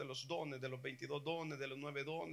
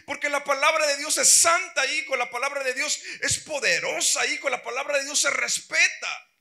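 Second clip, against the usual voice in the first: loud, higher, pounding rebuke; furious father; 8 semitones higher, 12 dB louder than usual. Retold or imitated. Father shouting at his ten-year-old about holiness, furious. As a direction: lecture the boy with full force. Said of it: "el papá se pone furioso".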